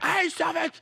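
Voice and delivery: high, softened mock yell; small-volume imitation of an outburst; imitating a yell. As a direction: squeal it high but held back. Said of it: "you yelling at me".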